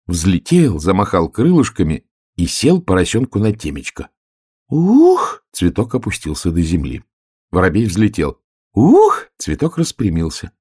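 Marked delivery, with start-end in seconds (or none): 4.7-5.4 high, loud "ukh"; loud swooping "ukh"; whoop. A narrator's reading. Sound effect of flower dipping. 8.7-9.3 high, loud "ukh"; loud swooping "ukh"; whoop. A narrator's reading. Sound effect of flower springing up.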